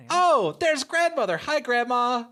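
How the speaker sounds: high, loud delighted hello; light up at seeing her; excited greeting. Real, not acted.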